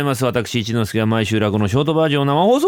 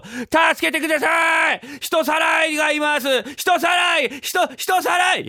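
high-pitched, drawn-out shouting; mock cry for help; 13 semitones higher, slower than usual. Boy publicly accusing father of kidnapping, mock screams for help. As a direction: scream for rescue as a put-on alarm.

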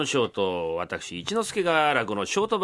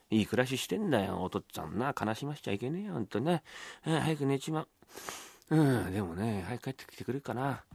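soft, low muttering to himself; idle solitary musing, hushed; stand-in book lender, musing alone; 9 semitones lower, 9 dB quieter than usual. Acted. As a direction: think aloud quietly while waiting upstairs.

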